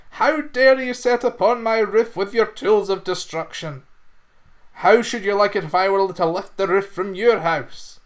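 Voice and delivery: a tiny creature furious at the trespasser; angry fairy. Acted. Angry fairy scolds intruder.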